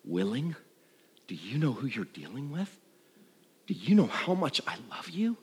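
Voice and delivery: softly, tenderly, a quiet loving question. Retold or imitated. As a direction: reassure him gently and softly. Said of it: "without words it communicated".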